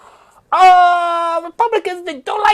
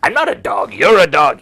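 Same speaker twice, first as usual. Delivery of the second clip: much lower, retorting voice; low mock retort of the dead man; imitating him; 14 semitones lower, 6 dB louder, faster than usual. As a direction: drop low for a defiant comeback. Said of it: "he said".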